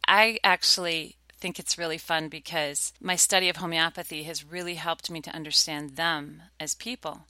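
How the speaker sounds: ordinary delivery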